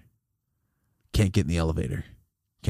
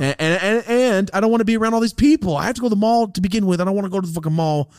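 heated rant; 8 semitones higher than usual. Real, not acted. Ranting about crowds.

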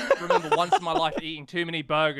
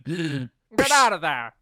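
mock scolding; 4 dB louder, much slower than usual. Acted scolding of someone else's kid.

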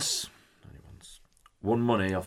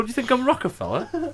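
mock indignant. Real, not acted. Mock indignation at small bet.

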